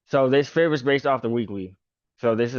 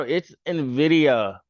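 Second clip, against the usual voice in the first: normal talking voice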